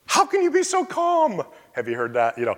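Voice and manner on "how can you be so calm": high, incredulous question; amazed onlooker. Retold or imitated. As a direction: ask it like you cannot believe their calm. Onlookers amazed at calm believer.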